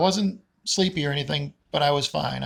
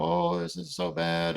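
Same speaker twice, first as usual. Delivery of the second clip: dramatic complaining; 3 semitones higher than usual. Retold or imitated. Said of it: "some people like to sound kinda like".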